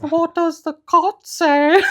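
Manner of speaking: high, slow, strange sing-song voice; imitating a weird high children's song voice. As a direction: mimic the odd singer from the kids' song. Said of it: "making such a weird voice noise".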